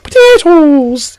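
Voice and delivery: high, drawn-out cartoon Irish; mock Irish accent. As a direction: stretch it slowly in a hammed-up Irish accent. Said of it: "the Irish is like".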